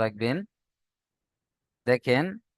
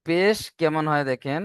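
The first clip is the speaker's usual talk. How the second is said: ordinary talk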